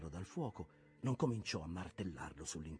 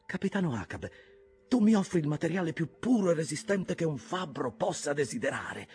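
much higher and louder in wonder; amazed smith; 13 semitones higher, 13 dB louder than usual. Acted. Pert marvelling at the captain's steel, high and loud. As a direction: gasp it out, astonished.

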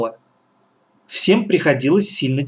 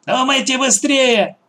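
put-on threatening old woman; 7 semitones higher than usual. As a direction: hurry them with a threat.

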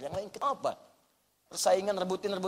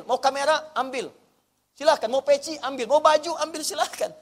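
high, loud, breezy take it all; carefree offer; 7 semitones higher, 6 dB louder than usual. Acted. Preacher playing a believer who lets others take everything, carefree. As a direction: wave away worldly things happily.

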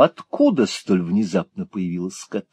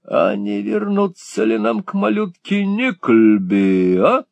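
slow, drawn-out and much higher; drawled question; 11 semitones higher, slower than usual. A narrator's reading. Малберри лениво дразнит приятеля, растягивая слова. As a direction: Sir Mulberry lazily teasing his friend, drawn out. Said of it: "протянул сэр Мальбери".

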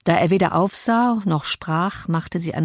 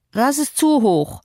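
slow, higher petulant invalid; peevish complaint; 7 semitones higher than usual. Acted. Linden fussing at Cathy over the pillow, slow and peevish. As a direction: drag the complaint out like a spoiled child.

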